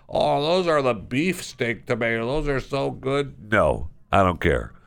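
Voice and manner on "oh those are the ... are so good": mock-gushing produce fan, a bit higher and softer; gushing shopper. Host mocking a precious produce-stand shopper, in a mock-gushing, higher, softer voice. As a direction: coo over tomatoes in mockery, not meaning it. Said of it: "your little cute".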